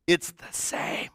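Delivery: hushed, slowed whisper. Shown as a demonstration. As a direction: breathe it as a quiet aside. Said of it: "repeated for emphasis".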